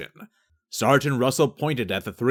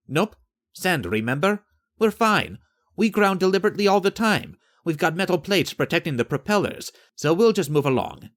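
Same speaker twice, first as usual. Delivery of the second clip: higher, unbothered sailor's voice; tossing off the facts lightly, pitched up; Estefan's breezy voice; 7 semitones higher than usual. Acted. Estefan reassures the sergeant.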